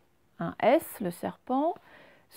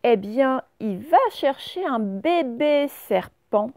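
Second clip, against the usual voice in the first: louder, livelier story voice; animated storytelling; 3 semitones higher, 6 dB louder than usual. Acted. Teacher telling the class the pickle's story, animated. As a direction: build the suspense for the children.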